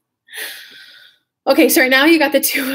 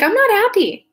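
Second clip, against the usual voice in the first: mock admission; 10 semitones higher, 3 dB louder than usual. Shown as a demonstration. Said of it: "When you're like".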